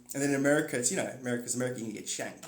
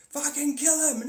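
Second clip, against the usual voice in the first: mock bloodthirsty yell; 11 semitones higher, 4 dB louder than usual. Players voiced in a scenario.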